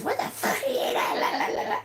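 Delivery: high, squeaky mock rant dissolving into babble; mocking angry ex. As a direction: mimic his fuming as gibberish, half laughing. Mimicking her angry ex's rant.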